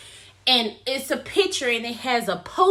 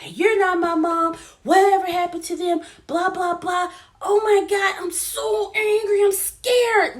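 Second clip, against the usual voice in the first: high, loud rush of anger and fear; emotional outburst; 7 semitones higher, 3 dB louder than usual. Retold or imitated. Chloe confronts her fake mom, high and loud outburst. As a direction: blurt it all out, climbing higher. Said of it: "and she's like".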